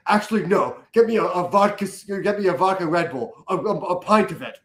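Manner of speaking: lightly voiced posh spy ordering; mock Bond order.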